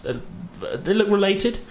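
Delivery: ordinary delivery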